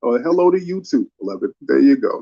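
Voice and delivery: normal talking voice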